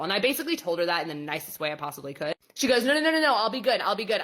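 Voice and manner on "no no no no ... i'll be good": frantic pleading. Retold or imitated. Client pleading not to be sent home.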